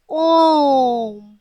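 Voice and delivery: "ओम" stretched very long and slow; drawn-out pluta vowel. Shown as a demonstration. Teacher shows the children a pluta vowel, slowly drawn-out "ओम". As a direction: hold the vowel of "ओम" as if calling from far off. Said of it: "अधिक समय लगाकर बनाया जा".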